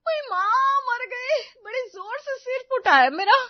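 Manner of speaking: very high, loud wail of pain; pained shouting. Acted. Suman yelling after pots fall on her head, pained shout. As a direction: cry out shrieking from a blow to the head. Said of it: "इतनी तेज आवाज में चिल्लाते".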